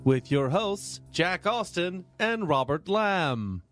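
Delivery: ordinary talk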